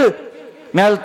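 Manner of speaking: normal talking voice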